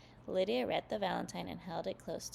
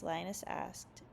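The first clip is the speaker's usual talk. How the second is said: usual speaking voice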